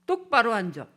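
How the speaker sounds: harsh father, sharper toward the end; stern dad scolding. Shown as a demonstration. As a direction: order the slouching child. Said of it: "이러면 그다음부터 벌써 소통이 안 돼요".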